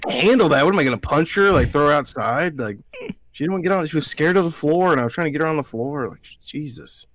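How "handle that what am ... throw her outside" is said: high, loud, incredulous questioning; incredulous retort. Retold or imitated. Nephew answering his aunt's demand about the dog, incredulous retort. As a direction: throw the words back in disbelief.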